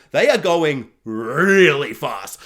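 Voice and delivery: slow, loud, drawn-out emphasis; loud and heavily drawn out; dramatic slow-down. Acted. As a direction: stretch the words out in mock intensity. Mimics film's intense speed effect.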